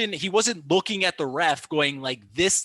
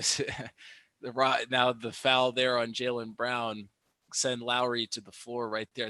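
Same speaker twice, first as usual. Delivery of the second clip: usual speaking voice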